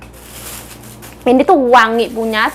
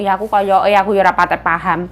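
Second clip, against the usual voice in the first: usual speaking voice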